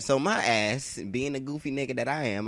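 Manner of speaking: ordinary delivery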